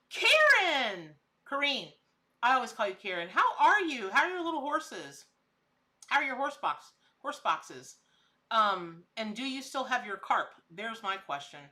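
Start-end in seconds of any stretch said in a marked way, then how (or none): 0.0-1.9 light up at a friend's name; delighted surprise. Real, not acted. Greeting a viewer she just spotted, delighted gasp, loud and high. 3.3-8.0 cheery greeting. Real, not acted.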